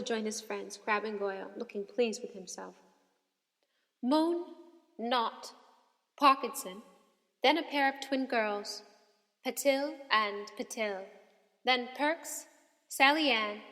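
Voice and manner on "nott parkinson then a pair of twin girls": plain reading voice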